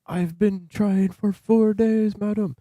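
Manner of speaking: high, slow put-on lady voice; slow falsetto impression of a pleading scammer; mock scammer voice. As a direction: imitate the scammer talking like a lady. Voicing the scammer.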